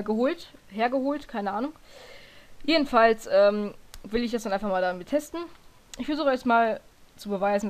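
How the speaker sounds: ordinary delivery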